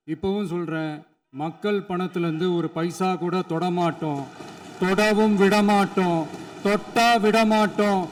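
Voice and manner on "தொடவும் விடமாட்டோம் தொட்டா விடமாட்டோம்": booming rally declaration, pitch climbing; loud public vow. Real, not acted. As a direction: thunder it out to the crowd. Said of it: "நான் ஏன் கொஞ்சம் சவுண்டா பேசுறேன்".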